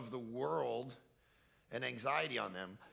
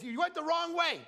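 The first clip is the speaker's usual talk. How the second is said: mock little kid; 13 semitones higher, 4 dB louder than usual. Little kids correcting dad.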